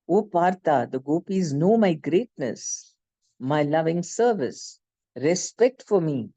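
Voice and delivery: normal talking voice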